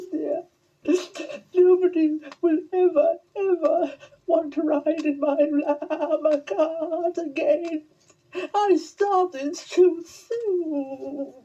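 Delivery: high, weepy, slow llama voice; high tearful llama character; crying llama. Acted. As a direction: sob it out as a heartbroken young llama. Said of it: "And he began to cry".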